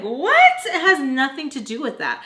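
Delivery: high, loud incredulous retort; indignant disbelief. Acted. Arguing for her phone with Mister Peach, indignant. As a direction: burst out with what, then protest.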